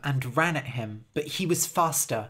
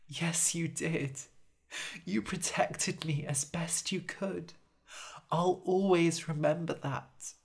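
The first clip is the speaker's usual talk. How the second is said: tender comfort; 6 dB quieter than usual. Acted. Jasper comforts Harold as he dies, soft and tender. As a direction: soothe him gently.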